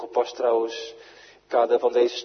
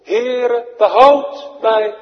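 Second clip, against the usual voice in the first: high, loud plea, last word stretched slow; desperate cry; 12 semitones higher, 14 dB louder, much slower than usual. Retold or imitated. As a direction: cry out to the Lord as you sink. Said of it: "Hij roept drie woorden".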